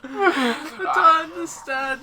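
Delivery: slow, high, breaking with laughter; can barely get it out laughing; laughing in disbelief. Real, not acted.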